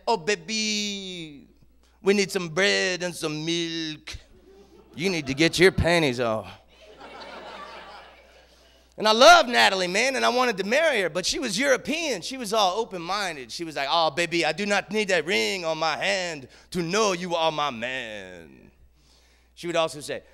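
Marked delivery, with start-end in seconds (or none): 0.1-6.5 put-on French accent. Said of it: "She had the greatest French accent". 13.9-18.7 a hint of her French accent. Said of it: "She had the greatest French accent".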